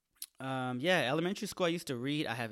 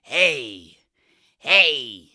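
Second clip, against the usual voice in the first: shouting hey; 19 dB louder than usual. Acted. Shouting over laugh.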